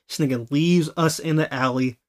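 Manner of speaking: normal talking voice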